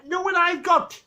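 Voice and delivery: high, chummy Geordie question; Geordie accent. Retold or imitated. Geordie guy offering Victor something, chummy Geordie accent. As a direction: lean in like a cheeky Geordie. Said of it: "Geordie guy says".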